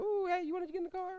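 high, fast put-on voice of a kid playing driver. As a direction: squeal it like a boy showing off his car.

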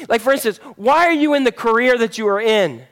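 ordinary talk

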